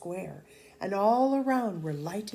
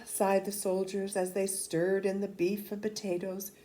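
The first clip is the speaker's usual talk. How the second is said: usual speaking voice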